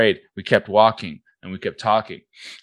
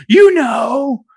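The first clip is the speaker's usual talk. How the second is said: high shouted whine; 8 dB louder than usual. Retold or imitated. Imitating the upset girl.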